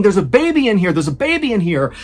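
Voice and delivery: acted alarmed yell, short of a full scream. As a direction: call it out urgently, loudest on the first cry. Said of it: "And so Beddoes began screaming".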